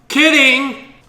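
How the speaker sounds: high, loud playful shout; teasing. Pretend. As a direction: shout it out as a tease. Joking about Carolina Reaper.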